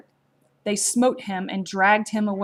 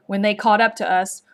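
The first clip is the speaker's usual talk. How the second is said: plain reading voice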